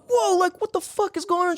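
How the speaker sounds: startled. Retold or imitated. Boyfriend startled by girlfriend's cry, high alarmed mock outburst. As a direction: jolt up as if woken by a scream. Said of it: "And I'm like".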